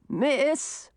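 high-pitched call for attention; old man calling to someone. A narrator's reading. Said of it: "called the old man".

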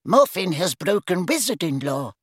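stern. A narrator's reading. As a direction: state the charge sternly and formally. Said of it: "said Ogden sternly".

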